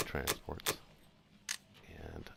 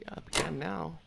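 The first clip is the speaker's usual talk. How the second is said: whispered; 11 semitones higher than usual. Real, not acted. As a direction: whisper it as an aside. Quiet aside about holding Russia.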